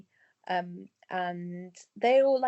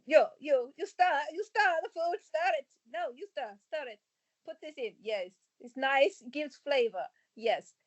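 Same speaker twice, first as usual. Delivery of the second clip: lightly voiced hag with vague Eastern European accent, higher; hint of an unplaceable old crone accent; 6 semitones higher than usual. Mum as cafe hag serving kids, in a light, vague Eastern European hag accent. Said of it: "Sounded like that, but more croaky".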